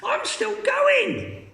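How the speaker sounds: put-on very high, slow screechy old woman. Grandma calling from the attic, very high screech. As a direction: screech it from above. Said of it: "the old screechy voice".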